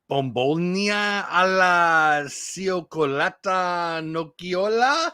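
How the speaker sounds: "Bombolina alla cioccolata nocchiola" slow and sung out with a put-on Italian lilt; mock Italian. Shown as a demonstration. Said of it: "I'm sure that's all wrong".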